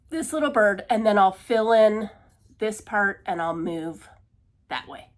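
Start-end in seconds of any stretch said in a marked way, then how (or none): none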